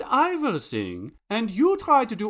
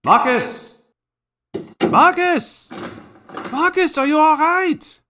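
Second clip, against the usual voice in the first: loud, high calls of alarm; alarmed shouting; 6 semitones higher, 7 dB louder than usual. Acted. Calling to partner after an explosion, alarmed shout. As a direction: shout to check on your partner.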